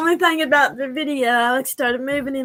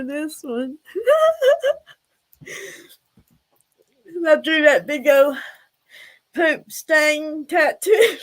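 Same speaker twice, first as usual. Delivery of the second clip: laughing; 4 semitones higher, 4 dB louder than usual. Real, not acted. Laughing retelling fingernail story.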